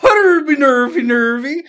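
mock Irish accent. Mocking the creep's Irish reply to her, loud. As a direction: belt a cartoon Irish "top of the morning". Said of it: "he responds with being like".

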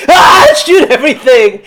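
high, loud panicked yell; frantic shout. Retold or imitated. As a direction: scream the order wildly.

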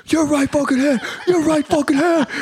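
high, strained instructor yelp; imitated drill instructor. Drill instructor correcting confused recruit, high imitated bark. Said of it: "the drum instructor's like".

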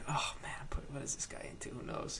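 near-whispered thinking aloud; very soft, voiceless muttered thought; retold inner thought. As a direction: drop to a hushed, worried aside to yourself. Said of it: "So I'm thinking".

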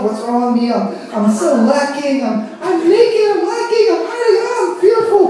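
self-pitying whine climbing very high; mock whining. Preacher mimics people focused on themselves, mock whining. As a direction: start near normal, rise into a high wail.

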